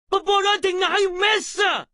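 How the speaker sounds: stern, shouted scolding; harsh telling-off at full voice; angry scolding. Acted. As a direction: scold forcefully, hammering out each order.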